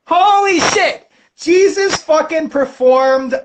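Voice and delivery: slow, high amazed exclaim. Acted. Healed man amazed at his new sight. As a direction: stretch it out in high-pitched awe.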